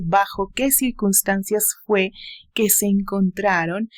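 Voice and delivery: plain reading voice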